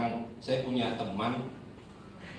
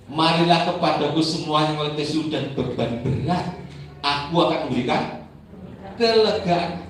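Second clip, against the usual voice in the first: louder preached scripture; preaching; 3 semitones higher, 5 dB louder than usual. Acted. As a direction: proclaim the verse to the congregation.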